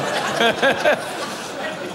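airy laugh rising in pitch; breathy laugh. Real, not acted. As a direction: let a breathy laugh climb. Laughs at own joke. (hauchiges, ansteigendes Lachen)

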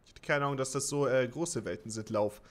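ordinary talk, nothing marked

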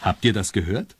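ordinary delivery

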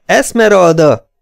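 high, loud, drawn-out name; repeated shout. A narrator's reading. Clayton shaking the fainted woman, shouting her name. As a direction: shout to bring someone round. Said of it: "kiáltotta újra".

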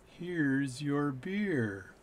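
very slow bartender, words drawn out; speaking really slow. Retold or imitated. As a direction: drag every word for the tourist. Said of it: "speak really slow".

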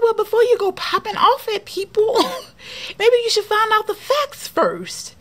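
high, loud retold telling-off; angry comeback. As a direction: fire back at the rude lady, way up high. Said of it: "And I was like".

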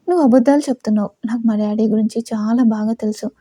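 ordinary delivery, nothing marked

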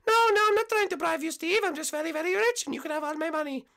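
high falsetto protest; falsetto, insisting innocence; pleading cyclist contestant. Acted. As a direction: protest in a put-on girl voice.

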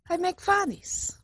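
put-on broken English, Russian accent; pleading son, Russian accent; fake Russian accent. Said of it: "fake Russian spy".

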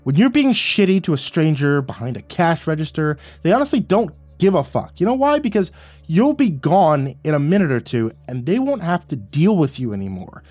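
loud, high angry tirade. A narrator's reading. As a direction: shout him down and keep hammering. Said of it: "I raised my voice and cut him off".

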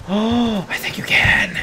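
soft, voiceless aside; whisper. Real, not acted. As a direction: gasp into a hushed whisper. Hushed discovery after a gasp.

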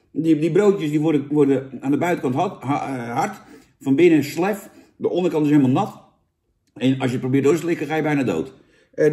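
ordinary talk, nothing marked

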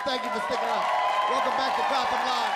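high, rousing MC announcement; live comedy club emcee; stage host introduction. Real, not acted. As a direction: whip up applause for the next act. Host introduces the next comic.

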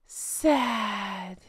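soft, quiet, droopy mock-sad sigh of a word. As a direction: sell the fake sorrow, softly. Mocking her staged sadness.

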